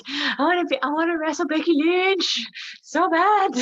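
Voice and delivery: high-pitched, excited mock fan voice; eager fan. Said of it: "'Cause they'd be like".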